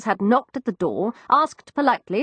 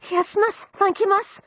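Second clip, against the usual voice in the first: put-on higher, young cockney maid; frightened servant girl, higher cockney; Florrie's voice; 13 semitones higher than usual. Narrator voices East End maid.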